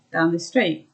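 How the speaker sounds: ordinary talk, nothing marked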